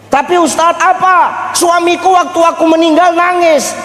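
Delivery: high, pleading wife's voice; mock wife's protest. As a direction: play the protesting wife. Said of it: "mimics a wife protesting". (meniru istri yang protes dengan suara tinggi)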